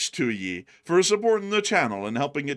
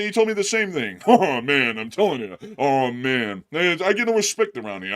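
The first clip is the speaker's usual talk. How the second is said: loud, higher hammy comedian bit; stand-up comic routine; 5 semitones higher, 6 dB louder than usual. Acted. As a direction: punch the one-liners like an old comic. Self-deprecating stand-up comedy routine.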